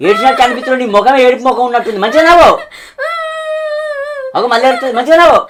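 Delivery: high, loud yelling; angry yell. Acted. Drunk husband yelling at his crying wife to laugh properly.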